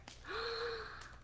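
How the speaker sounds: high mock gasp. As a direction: gasp big for the kids. Bears find Goldilocks.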